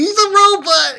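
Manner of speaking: put-on high-pitched excited kid freak-out; gushing mock fanboy squeal; excited boys. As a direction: imitate awestruck boys shrieking. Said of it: "They're so excited".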